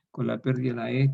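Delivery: ordinary talk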